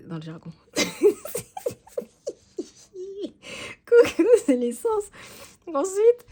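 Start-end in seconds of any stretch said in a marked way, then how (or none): 0.7-1.7 short high-pitched giggle. Real, not acted. Laughs at own jargon. 3.8-6.2 laughing. Real, not acted. Repeats jargon word laughing.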